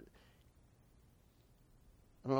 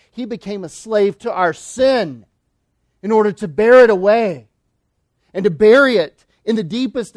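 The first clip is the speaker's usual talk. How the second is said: louder, rising sermon climb; preaching at full force; 6 semitones higher, 9 dB louder than usual. Acted. Preacher to his flock on Christ bearing sin, preaching at full force. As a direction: proclaim it to the whole congregation.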